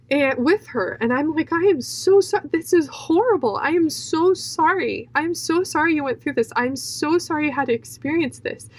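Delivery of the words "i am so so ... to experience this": high, pleading sympathy, imitating oneself crying; distressed, higher-pitched consoling; retold tearful apology. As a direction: pour out mock-tearful condolences in a raised voice. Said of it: "I'm just crying with her".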